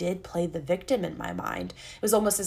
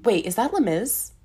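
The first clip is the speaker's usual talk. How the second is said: normal talking voice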